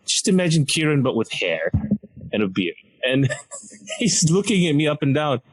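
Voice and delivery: ordinary delivery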